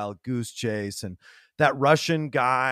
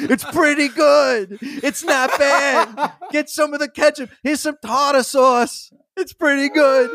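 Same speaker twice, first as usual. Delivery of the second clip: high, loud, laughing mock gangster voice; hammed-up Paulie with ketchup; imitating Paulie eating ketchup; 13 semitones higher, 9 dB louder than usual. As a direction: squeal it out like a delighted wiseguy. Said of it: "just going like".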